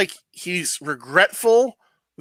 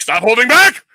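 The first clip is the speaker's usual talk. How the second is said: loud, high, rising shout; hammed-up shouted movie command; acted coach's yell; 7 semitones higher, 8 dB louder than usual.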